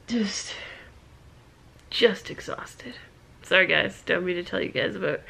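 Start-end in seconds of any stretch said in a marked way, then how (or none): 0.1-2.9 drained, breathy whisper; fading out, worn to nothing; exhausted whisper. Real, not acted. Exhausted mom trailing off.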